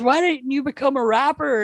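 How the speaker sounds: high-pitched amazed question; imitating impressed woman. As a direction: ask like an awestruck female onlooker. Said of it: "she goes".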